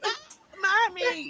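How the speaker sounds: high, soft whimpering child voice, a little mocking; whiny little kid. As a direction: mimic a scared kid calling for mom. Kids crying for mommy.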